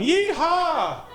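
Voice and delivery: very high, drawn-out whoop; crowd yeehaw imitation. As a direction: whoop it like a whole Southern crowd. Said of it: "fifteen thousand people going".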